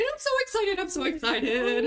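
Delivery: high-pitched overjoyed squeal; excited. Acted. Water genasi meets another genasi, high excited squeal. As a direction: burst out in bubbly, energetic delight. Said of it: "she is just the most energetic".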